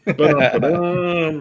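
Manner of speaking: drawn-out, high, loud "ba-dum-ba-dum"; mock rimshot jingle. Friend capping a teammate's joke, mock rimshot jingle. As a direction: belt out a slow comic sting. Sung joke tag after a jab.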